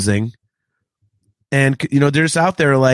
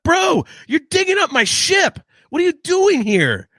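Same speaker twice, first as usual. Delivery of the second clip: mock outrage; 13 semitones higher than usual. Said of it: "I'm like".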